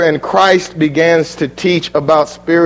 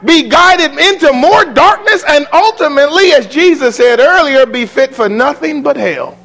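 raised, ringing pulpit voice, much higher and pressing on; preaching at full force; 9 semitones higher than usual. Acted. Preacher warning his flock, preaching at full force. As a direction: thunder it over the congregation.

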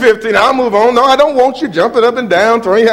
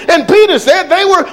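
normal talking voice

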